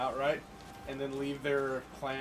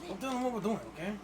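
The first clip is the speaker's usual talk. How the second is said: put-on playful Chicano accent; hammed-up Chicano accent; 10 semitones higher than usual. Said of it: "I just love that accent so much".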